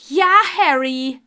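loud, exasperated answer; annoyed. A narrator's reading. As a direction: snap back at an interruption. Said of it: "sagte sie genervt".

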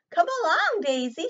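high, loud shout. Acted. Mama Duck shouting for Daisy, high and loud. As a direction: call out sharply to a wandering child. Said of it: "shouted Mama".

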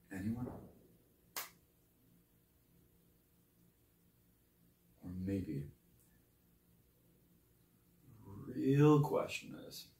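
very soft, with long pauses; hushed, cutting questioning; quiet challenge. Acted. Quiet challenge after the tirade.

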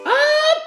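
sudden high mock sneeze. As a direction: fake a big elephant sneeze. Elephant sneeze in song.

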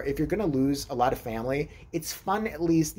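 usual speaking voice

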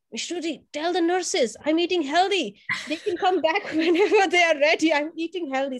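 put-on a child boasting he eats healthy; proud kid.

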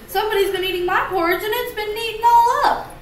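put-on Baby Bear. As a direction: squeak it out like an upset cub. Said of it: "The baby bear looked at his bowl and said".

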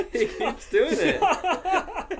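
high, slow words through laughter; helpless laughter. Real, not acted. Reader losing it over a repeated joke, laughing hard. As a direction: squeal it out while cracking up. Cracking up at the story.

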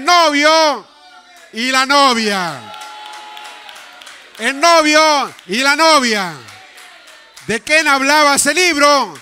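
loud and high, stretching the words; full-force preaching. Acted. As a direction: shout it out like a revival preacher. (predicación a gritos, aguda y fuerte)

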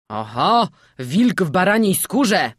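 loud accusing call from the crowd; shouted jeer. A narrator's reading. Old townsman heckles the captured stranger, shouting. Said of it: "krzyknął stary jakiś mieszczanin".

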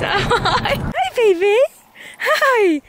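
high, doting hello to the dogs; dog greeting voice. Real, not acted. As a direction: coo at the puppy.

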